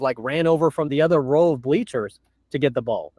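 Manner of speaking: ordinary talk, nothing marked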